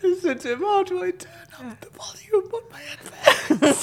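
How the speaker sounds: helpless, high-pitched giggling, barely getting words out; squeaky uncontrollable laughter through the words; real laughing fit. Real, not acted. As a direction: try to apologise while cracking up, voice squeaking. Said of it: "I don't know why I'm laughing".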